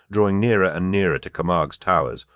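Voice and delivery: usual speaking voice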